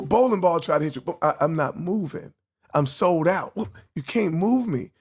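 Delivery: ordinary talk, nothing marked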